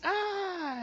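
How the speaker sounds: piercing high scream; short shrieking cry of fright; terrified scream. Retold or imitated. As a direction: let out a sharp, loud scream. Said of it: "and he screams".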